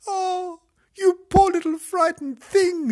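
put-on very high, cooing child's sympathy; pitying girl. A narrator's reading. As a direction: gush over a frightened puppy, high and loud. Imogen comforts the frightened puppy.

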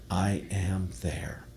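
slow whisper of God's voice; hushed whisper. Pretend. Minister voicing God to the congregation in a slow whisper. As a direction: breathe it out slowly in a whisper.

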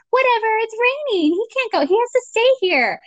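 acted-out high-pitched insistence; mock breezy dismissal. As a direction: wave the objection away in a high voice. Family overrules the doctor, acted out.

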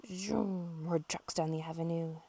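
plain reading voice

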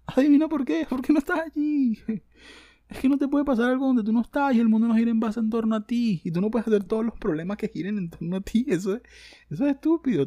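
high, loud mocking scolding; heated rant. Real, not acted. Scolding the poster as silly, heated rant. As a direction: tear into them.

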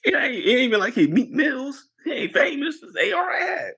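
high, wailing disbelief; indignant squeal to a friend; comic outrage. Real, not acted. Man telling friend about the humiliating song, comic outrage.

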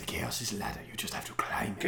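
put-on very soft, low whisper in Irish-Welsh accent; Littlefinger's accent. Mimicking Littlefinger's motto, creepy whispered Irish-Welsh accent. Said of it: "What the hell is his accent?".

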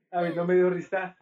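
ordinary talk

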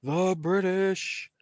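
slow, stretched winner announcement; ring announcer. Acted. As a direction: draw out the winner's name.

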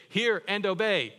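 put-on loud, high "Hear and obey" like telling kids; firm command to kids. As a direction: bark it out as a parent's order. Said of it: "what we tell our kids is we always say".

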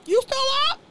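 loud, high-pitched imitated grandma. Grandma walking in minutes later, high loud mock call. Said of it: "grandma came in like".

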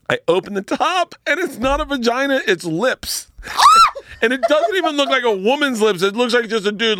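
usual speaking voice

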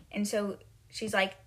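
usual speaking voice

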